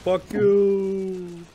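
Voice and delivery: high, loud, long-held last word; drawn-out taunt. Pretend. Taunting enemies in the game, long high call. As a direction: call it out, stretching YOU. Calling out while attacking in game.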